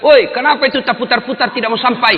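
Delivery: high, loud heckling yell; retold shout. Student heckles the imam stuck in his recitation, shouting. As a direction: yell a protest from the rows, high-pitched. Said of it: "dia teriak".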